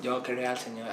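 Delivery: soft mock gringo Spanish, laughing; lightly imitating an American accent. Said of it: "mi español no es bueno". (español con acento americano)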